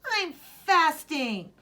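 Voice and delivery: high, loud, slow declaration; proud answer. Acted. Boy answers his teasing sister, loud and slow. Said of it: "he answered".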